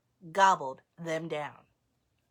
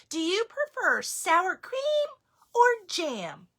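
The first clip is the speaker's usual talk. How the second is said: higher grandma voice; grandmother voice; 10 semitones higher, 4 dB louder than usual. Acted. Baba Brena offering toppings, higher voice. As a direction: ask sweetly like a grandma. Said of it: "Baba Brena asked".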